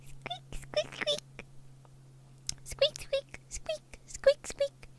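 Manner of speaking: tiny, very high squeaking, mostly breathy; bat squeaks. Retold or imitated. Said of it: "They're like little kitty sounds".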